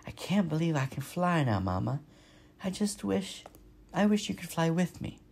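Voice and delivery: drowsy, drifting little voice; half asleep, words trailing; sleepy voice. A narrator's reading. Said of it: "in his sleepy voice".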